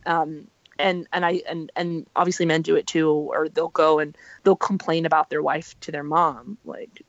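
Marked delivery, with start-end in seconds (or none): none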